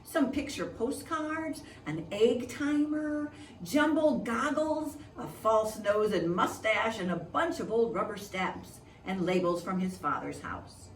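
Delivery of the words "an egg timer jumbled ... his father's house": plain reading voice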